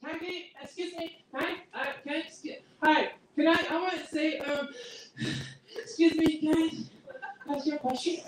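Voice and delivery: put-on high, halting little kid voice; pestering kid. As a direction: stumble over the words like a shy child. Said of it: "They're like".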